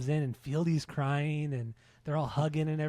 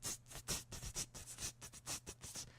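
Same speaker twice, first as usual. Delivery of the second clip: whispered tss hi-hat beat; hi-hat mouth sound; 18 dB quieter than usual. Retold or imitated. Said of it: "just doing the hi-hats, like".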